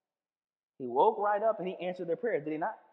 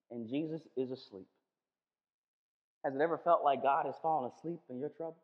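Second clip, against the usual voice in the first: usual speaking voice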